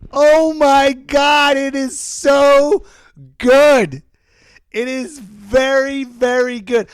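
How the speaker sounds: high, slow, drawn-out gushing; hammed-up rapture at the film; gushing rave. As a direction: swoon over the movie like a superfan. Gushing over the movie.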